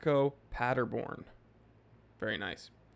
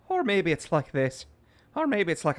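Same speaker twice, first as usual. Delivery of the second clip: higher-pitched put-on Irish accent; Tom Mulrooney; 4 semitones higher, 4 dB louder than usual. Streamer trying another Irish voice for chat, playful Irish accent. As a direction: lilt it in playful mock Irish. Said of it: "That was Tom Mulrooney".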